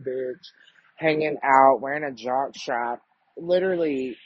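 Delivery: normal talking voice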